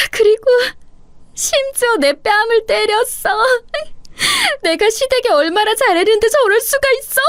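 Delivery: crying to dad. Acted. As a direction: pour out the hurt to her father through tears. Daughter crying to father on phone. (아빠에게 울면서 하소연하는 목소리)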